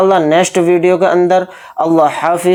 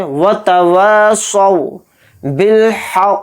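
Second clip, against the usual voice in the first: slow, drawn-out tajweed chant; Quran recitation; much slower than usual. Shown as a demonstration. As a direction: recite it slowly and melodically. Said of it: "तो ध्यान से देखें".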